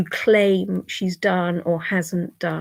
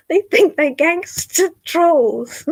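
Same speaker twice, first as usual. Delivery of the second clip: higher, slow, mocking tone; mocking laugh; 8 semitones higher, 3 dB louder, slower than usual. Real, not acted. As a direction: draw it out with scornful amusement. Mocking trolls.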